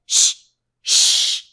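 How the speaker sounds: a sharp whispered shh, twice; hushing. A narrator's reading. Hatter and March Hare hushing Alice, whispered shh. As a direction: hiss them into silence. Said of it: "the Hatter and the March Hare went".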